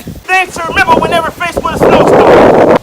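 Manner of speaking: loud shouted tips; shouting over wind. Acted. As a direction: yell it over a storm.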